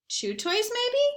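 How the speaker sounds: usual speaking voice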